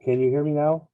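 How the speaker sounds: muffled headset mic; hollow, distant, like in a tunnel; sounds like in a tunnel. Real, not acted. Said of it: "you sound like you're in a tunnel".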